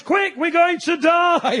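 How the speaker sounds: hammed-up slow panicked shout; mock panic. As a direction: yell it like fleeing a bomb. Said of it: "Like that".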